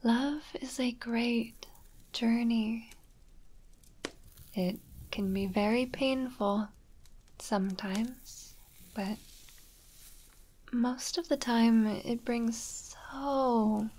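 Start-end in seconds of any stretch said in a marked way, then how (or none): 0.0-11.2 soft whisper. Acted. Whispered affirmation.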